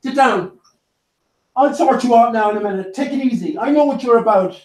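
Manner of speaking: high-pitched mock scolding; feigned indignation. Owner warning the dog to settle, mock-indignant. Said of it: "it's sort of feigned indignation".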